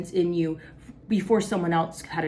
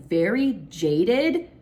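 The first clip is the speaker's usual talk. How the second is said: drawn out slowly; 2 semitones higher, 4 dB louder, much slower than usual. Real, not acted. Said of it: "let me just say".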